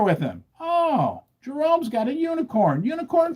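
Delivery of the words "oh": ordinary talk, nothing marked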